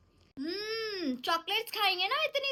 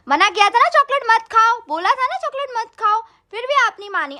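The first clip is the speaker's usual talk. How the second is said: scolding; 3 semitones higher, 8 dB louder than usual. Acted. Mother scolds child over chocolate.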